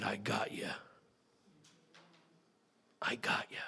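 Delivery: breathy reassuring whisper; gentle whisper. Retold or imitated. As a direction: whisper it like a promise. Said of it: "the Spirit of the Lord said".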